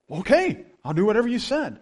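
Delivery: high, meekly compliant imitation; cowed little boy. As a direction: give in like an overawed kid. Imitates cowed boy obeying the man.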